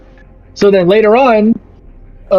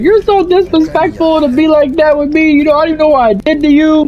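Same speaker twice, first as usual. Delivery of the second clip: high-pitched, offended mock of the coworker; indignant coworker; 8 semitones higher than usual. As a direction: play her huffy and wounded, pitched way up. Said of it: "She's like".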